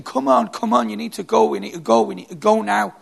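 high, loud urging to keep moving; urgent pleading. Retold or imitated. Rescuer on the stairs pleading with the exhausted man, urgent and loud. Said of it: "the Korean guy stood there saying".

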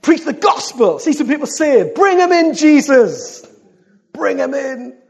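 loud, high-pitched rallying cry; full-force preaching. Real, not acted. Preacher rallies the church to get people saved, full-force preaching. Fervent shouted exhortation to the congregation.

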